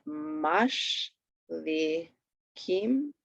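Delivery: "mash", "the", "kim" slowly spaced, rising last; syllable by syllable. Shown as a demonstration. Teacher reading an accent example, "mash the kim" broken up. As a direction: leave gaps between "mash", "the", "kim". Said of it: "read the accent".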